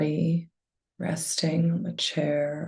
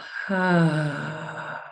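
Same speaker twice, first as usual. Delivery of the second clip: long, drawn-out exhaling sigh; audible sigh. Shown as a demonstration. Leading listeners in slow breaths together, audible sigh. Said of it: "out with an audible sigh".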